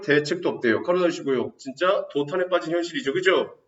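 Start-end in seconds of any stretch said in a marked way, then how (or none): none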